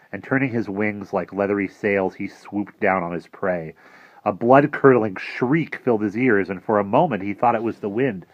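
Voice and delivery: ordinary delivery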